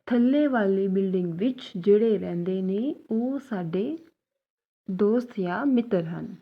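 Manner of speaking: slow, careful Punjabi sentence; slow teaching demo. Shown as a demonstration. Teacher slowly models the corrected Punjabi sentence. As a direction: lay each word out clearly for the class. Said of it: "इस तरह से".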